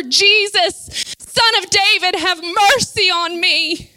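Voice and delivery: high, forceful cry to Jesus; fervent preacher's cry for mercy; loud crying out. Acted. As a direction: cry out, drawing out the plea. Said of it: "willing to cry out even louder".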